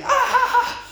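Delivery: very high-pitched imitation wail; high mock shriek; mock toddler scream. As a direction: scream like a startled toddler, playfully. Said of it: "And he starts screaming".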